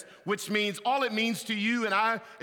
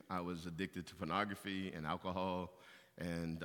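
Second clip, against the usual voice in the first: much softer, lower inner prayer to God; quiet prayer; 10 semitones lower, 11 dB quieter than usual. Retold or imitated. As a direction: confess humbly, almost to yourself. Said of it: "I'm sitting there thinking".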